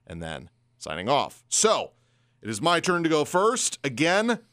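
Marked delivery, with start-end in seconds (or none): none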